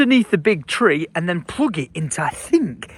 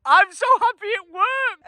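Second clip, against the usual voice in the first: ordinary delivery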